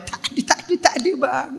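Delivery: breathless high whisper; whispered denial. Retold or imitated. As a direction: deny it under your breath.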